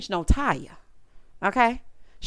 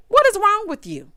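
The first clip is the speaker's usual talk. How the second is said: exasperated yell; 10 semitones higher, 6 dB louder than usual. Real, not acted. Scolding a cast member on screen.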